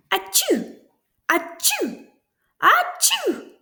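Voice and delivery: high, loud comic sneezes; three sneezes. A narrator's reading. Pigs sneeze one after another, high sneezes. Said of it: "sneezed the three little pigs".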